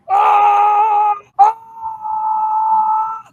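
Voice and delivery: long, very high mock scream; hammed-up shriek of terror, held on and on; screaming like little girls. Grown men terrified on the boat, screaming like little girls. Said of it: "Grown men screaming like little girls".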